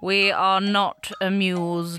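slow, regal royal voice; a queen, dry and unimpressed; stately queen. Acted. England 1837, the queen speaks.

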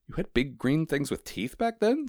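high-pitched incredulous question; high-voiced amazed postman. A narrator's reading.

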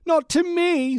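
very high, loud hammed-up brat; mock-haughty high-pitched retort; spoiled rich kid. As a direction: pipe up like a pampered heir. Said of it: "says Jack".

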